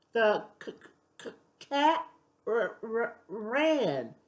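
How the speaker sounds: halting, stuttered reading aloud; stumbling reader. Acted. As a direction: sound out each word, stumbling. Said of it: "She was reading like a baby".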